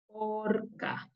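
"Orca" in two parts, long "or", then "ca"; sounding out. Shown as a demonstration. Teacher asks children to help read, "Orca" sounded out. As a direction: softly stretch "Orca" syllable by syllable. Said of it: "¿Me ayudan a leer?".

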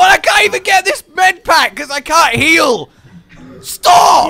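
high, rising to a shout; exasperated complaint. Real, not acted. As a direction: complain loudly until it becomes a yell. Cannot heal, being attacked.

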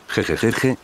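ordinary delivery, nothing marked

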